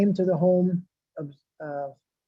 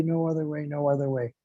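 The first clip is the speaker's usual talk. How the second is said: no other way repeated, much softer, like an echo; 3 semitones lower, 6 dB quieter than usual. Shown as a demonstration. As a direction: let the second one fall back and fade. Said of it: "That's three times Hare Nama".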